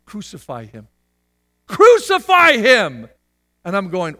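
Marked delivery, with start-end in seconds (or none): 0.1-0.9 low, hushed report of a shout; understated quote. Retold or imitated. Preacher reports the crowd's verdict, understated. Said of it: "to the shouts of". 1.7-3.1 loud, slow, raised cry; crowd's shout. Acted. Preacher acts the crowd condemning Jesus, shouting. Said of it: "to the shouts of".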